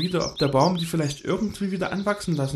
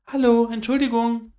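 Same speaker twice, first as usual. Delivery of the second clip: louder and higher, calling up to the trees; a little squirrel raising its voice to be heard; etwas lauter; 8 semitones higher, 6 dB louder than usual. A narrator's reading. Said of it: "mit etwas lauterer Stimme". (etwas lauter rufend)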